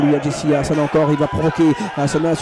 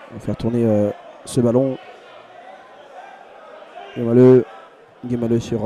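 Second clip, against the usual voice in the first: ordinary talk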